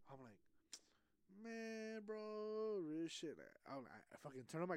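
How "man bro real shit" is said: long, held-out words at a level pitch; slow, droning "man, bro"; drawn-out reaction. Retold or imitated. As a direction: stretch each word out, lamenting. His own drawn-out reaction, retold.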